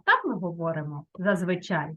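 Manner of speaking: ordinary talk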